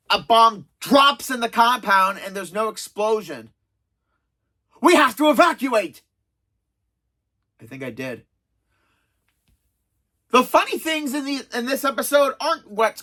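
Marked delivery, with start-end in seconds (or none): 4.8-6.0 raised, higher urgent cry; acted emergency yell; mock alarmed shout. Panicked order after bomb drops. 7.6-8.3 low, very soft aside; hushed, dropped-down acted admission; small quiet reply. Muted reply in acted scene.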